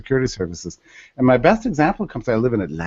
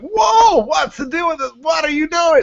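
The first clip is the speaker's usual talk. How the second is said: high, loud startled whoa; amazed shout; 3 dB louder than usual. Retold or imitated. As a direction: call out in wide-eyed surprise at the hoverboard guys. Onlookers amazed at hoverboards.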